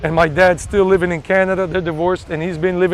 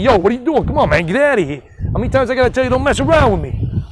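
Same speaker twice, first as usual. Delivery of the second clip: higher, animated New York street tough-talk; scolding someone in brash New York dialect; New York Yankee accent; 4 semitones higher, faster than usual. Acted. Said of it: "New York Yankee sometimes".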